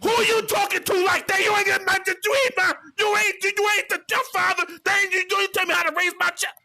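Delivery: put-on screaming woman scolding boyfriend; angry shout. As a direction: play the furious girlfriend storming out of the kitchen. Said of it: "she came out the kitchen".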